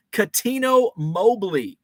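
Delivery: ordinary talk, nothing marked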